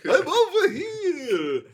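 very high, drawn-out cartoon voice; hammed-up comic character, far above normal pitch; Scam Likely. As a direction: squeal it loudly, stretching each phrase. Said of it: "That's my Scam Likely character".

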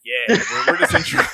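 very high, laughing mock bravado; edgy church kid. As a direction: squeak it out, cocky and laughing. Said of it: "We're like".

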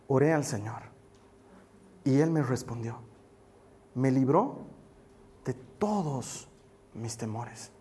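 low, hushed verse reading with pauses; quiet scripture reading. Real, not acted. Pastor reading David's psalm to the congregation, low and measured. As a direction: read the psalm softly, phrase by phrase. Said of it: "Te lo quiero leer otra vez".

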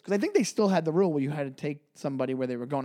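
ordinary talk, nothing marked

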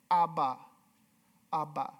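low, simple toddler babble, lightly imitated; like a child. Shown as a demonstration. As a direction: say it lightly as a small child's first word. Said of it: "I'm saying it like a child".

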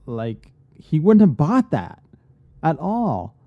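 high, loud exasperated protest; indignant rant. Real, not acted. Fan who left the movie so mad, ranting indignantly. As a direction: build into an outraged complaint. Ranting about a film's mistake.

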